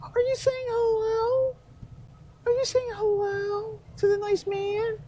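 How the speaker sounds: put-on very high sing-song baby talk. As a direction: coo at a toddler in baby talk. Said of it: "she's in her baby voice".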